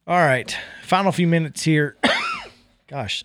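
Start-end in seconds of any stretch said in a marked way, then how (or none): 2.4-3.2 raspy cough then a muttered word; coughing mid-sentence, a bit hoarse; chesty cough. Real, not acted. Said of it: "I keep coughing".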